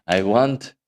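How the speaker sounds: badly faked accent of an Ecuadorian learning English. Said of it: "he's got that accent".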